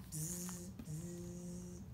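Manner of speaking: soft, low imitated buzzing; fly buzz. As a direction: buzz quietly like tiny flies. Buzzing flies in the story.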